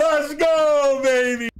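loud hyped cheer; excited shout. Real, not acted. Celebrating kill.

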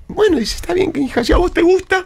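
a reluctant mum in a high put-on voice; high mother voice. Reluctant mother answers her daughter in high voice.

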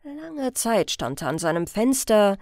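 ordinary delivery

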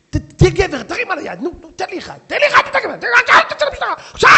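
screaming wife daring her husband to hit her; taunting furious wife. Acted.

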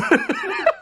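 shrieking high laugh; high burst of laughter. Real, not acted. Laughs as daughter uses his name.

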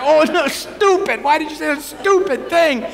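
high, panicked self-scolding; scolding inner voice. Retold or imitated. Priest scolding himself after blurting to the Pope, high and panicked. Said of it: "And I was like".

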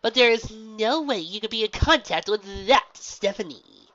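scoffing. Acted. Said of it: "the gym leader scoffed".

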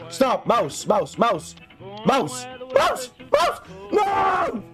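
high panicked yells; panicked shouting. Real, not acted. Panicked at mouse in game.

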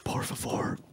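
hushed Spanish whisper. Pretend. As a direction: breathe the words, no voice. Sudden whispered Spanish line.

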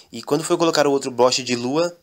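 ordinary delivery, nothing marked

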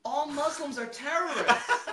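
high-pitched mock-offended girl; sarcastic accusation. Offended party girl accusing a costumed guest, high sarcasm. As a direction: imitate her sugary setup turning into accusation.

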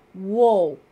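loud, alarmed cry. A narrator's reading. Suspect surrenders to a drawn gun, crying out. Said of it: "Brett cried out".

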